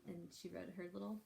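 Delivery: usual speaking voice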